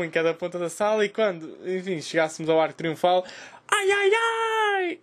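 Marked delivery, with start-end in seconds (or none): 3.7-5.0 very high, drawn-out squeal; mock cry-out. Comedian demonstrating the agreed cinema signal, high mock cry. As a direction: stretch out a comic high-pitched signal cry. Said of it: "começa a gritar".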